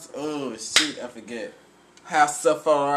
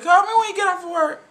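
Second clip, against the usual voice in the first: much higher, louder and fast; woman's voice quote; 8 dB louder, faster than usual. Retold or imitated. Friend quoting the movie woman, high and fast. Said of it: "she said".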